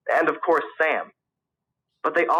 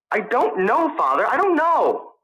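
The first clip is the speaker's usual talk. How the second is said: flustered protest; 10 semitones higher, slower than usual. A narrator's reading. Son pressed about who fired.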